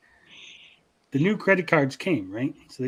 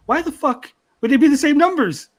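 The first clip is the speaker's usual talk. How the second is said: गुस्से में ऊँची आवाज़ में चिल्लाना; बहुत ऊँची और ज़ोरदार झल्लाहट; exasperated yell; 10 semitones higher, 6 dB louder than usual. Retold or imitated. Yelling at dad about card numbers.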